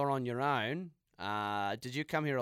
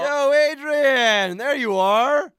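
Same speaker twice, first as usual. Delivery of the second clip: calling out; 12 semitones higher, 14 dB louder, much slower than usual. Acted. Spotting a friend at party.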